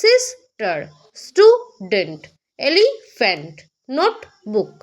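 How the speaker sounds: Sister, student, elephant, notebook each broken in two by a pause; paused mid-word. Shown as a demonstration. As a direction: halt once inside each word, as in সিস... টার, stew... dent, এলি... ফ্যান্ট, নোট... বুক. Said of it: "মধ্যিখানে উচ্চারণ করার সময় একবার করে থেমেছি".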